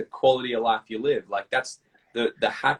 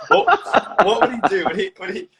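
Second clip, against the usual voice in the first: laughing; 8 semitones higher, 4 dB louder than usual. Real, not acted. Giggling imagining the slap.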